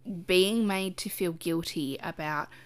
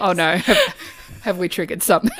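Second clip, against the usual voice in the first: laughing; 3 semitones higher, 11 dB louder than usual. Real, not acted. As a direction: laugh as you tease. Teasing friend laughs.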